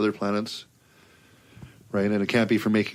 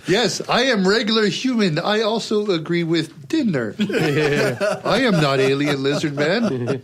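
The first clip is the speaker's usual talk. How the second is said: stiff fake human voice; mock alien claiming to be human; robotic mock human; 7 semitones higher, slower than usual. Joking he is human.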